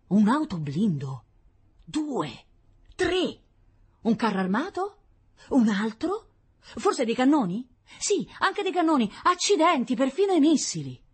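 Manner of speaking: high, loud exclamations, building; mounting excitement. Acted. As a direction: count the sightings with rising amazement. Excited sighting of army vehicles.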